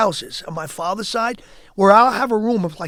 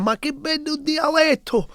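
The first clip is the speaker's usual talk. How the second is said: slow, higher Sicilian Italian; Sicilian dialect quote; 7 semitones higher than usual. Retold or imitated. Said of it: "He even told me".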